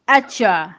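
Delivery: "archer" as atcha, no K sound; reduced consonant cluster. Shown as a demonstration. Teacher shows learners the reduced cluster, K left out. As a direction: drop the K and say "archer" as achua. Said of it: "instead of actual".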